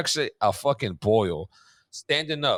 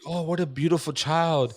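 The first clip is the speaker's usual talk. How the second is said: higher, slow sweet-lady voice; admiring lady; 5 semitones higher, slower than usual. Retold or imitated. A stranger at Chipotle admires the baby, sweet and slow. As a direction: gush over the beautiful child. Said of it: "some lady was like".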